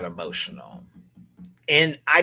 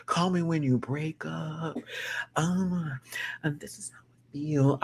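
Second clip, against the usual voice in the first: slow, softer mock of Athena lip syncing; imitating Athena; 4 semitones higher, 5 dB quieter, slower than usual. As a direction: play her dreamy and slow, eyes closed. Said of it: "Athena over here".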